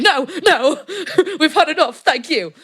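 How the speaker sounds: high, loud, laughing no thanks; laughing, overwhelmed no thank you; laughing refusal. Retold or imitated. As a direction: wave them off through giggles. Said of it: "we're like".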